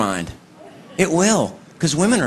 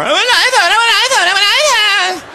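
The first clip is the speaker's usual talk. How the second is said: very high, sing-song whine; whining kids; 3 dB louder, faster than usual. Retold or imitated. Kids at the mall whining for an iPhone, very high. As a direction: beg high, like a nagging child. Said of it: "begging me for an iPhone".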